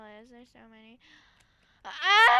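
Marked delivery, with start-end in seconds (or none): 1.8-2.4 long, high, loud "aah"; sudden drawn-out scream. Pretend. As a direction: let out a sustained yell. Scream while searching in game.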